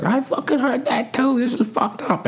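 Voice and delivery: very high, slow mock old-man voice; second old barfly. Second old man at the bar answering, high squeaky mock outrage. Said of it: "And the guy's like".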